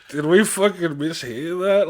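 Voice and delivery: slow, loud and high through laughter; laughing disbelief. Retold or imitated. Friend recalling the cinema moment, laughing. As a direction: laugh while asking in disbelief. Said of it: "We're just like".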